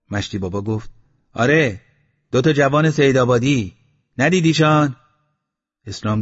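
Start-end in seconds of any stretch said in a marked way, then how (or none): 1.3-4.9 raised, higher voice carrying down from above; calling down. A narrator's reading. Mashti Baba calls down to Eslam, raised voice. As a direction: call the news down to him. Old man calling down from roof.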